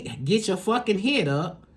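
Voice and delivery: usual speaking voice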